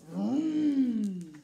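loud, held engine vroom; big rumbling vroom for the kids. Pretend. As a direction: rev it like a truck engine. Truck engine sound in story.

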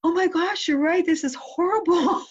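high-pitched, mock-distressed agreement; almost crying, retold. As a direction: act out being moved and appalled. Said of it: "I almost cried right there".

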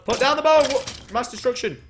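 high, loud command; urgent shout. Pretend. Gamer facing a skeleton with a bow, urgent shout. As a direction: order the attacker to disarm. Panicked order to attacking skeleton.

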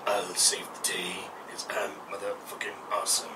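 low whispered boast; whispered brag. Pretend. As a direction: whisper it smugly.

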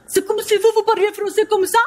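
usual speaking voice

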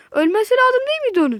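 high, loud and fast; rapid indignant shout; incredulous outburst. Real, not acted. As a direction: protest in disbelief. Enemy survived shots.

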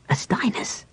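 breathless whispered surprise; stunned breathy whisper; gasped whisper. Acted. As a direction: gasp the name in shock. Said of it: "Tanis gasped".

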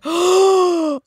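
big high gasp into a drawn-out "oh"; mock amazed gasp. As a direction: imitate starstruck fans gasping. Retold fans recognizing the actors.

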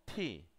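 "T" said as English "tee"; airy, demonstrated "T", as "tee"; aspirated T. Shown as a demonstration. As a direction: release "T" with a clear puff of air. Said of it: "para poder pronunciar de ese T".

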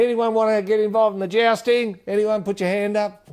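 high, loud announcer calling out; sustained high-pitched announcer's holler; event announcer. Acted. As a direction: call out to the crowd for volunteers. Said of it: "announcer just goes around saying".

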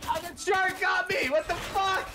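high outraged shouting; shocked shout. Real, not acted. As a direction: shout in disbelief. Killed by shark.

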